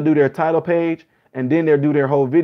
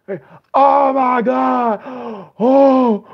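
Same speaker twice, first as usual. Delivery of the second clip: high, slow, shouting mock sobbing gasp; theatrical wail of a fake music reactor; mock overwhelmed cry; 9 semitones higher, 4 dB louder, much slower than usual. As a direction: ham up a reactor overcome by music.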